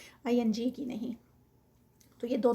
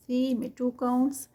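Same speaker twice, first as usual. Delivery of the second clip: ordinary talk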